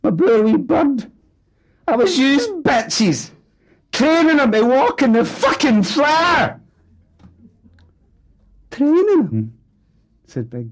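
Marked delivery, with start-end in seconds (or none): 0.0-6.6 high, loud, strained rage; a fat father exploding in grief and fury; shrieking aggrieved tirade; furious outburst. Acted. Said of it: "wound himself up for the offensive". 8.7-9.5 high, loud, here-we-go-again scorn; mock the very idea with contempt; wife scoffing at her husband's excuse; scornful. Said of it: "in a scornful, "Here we go again" tone".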